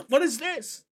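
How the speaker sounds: high squeaky question; Ursa confused. Acted. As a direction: squeak it out, baffled. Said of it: "She's like".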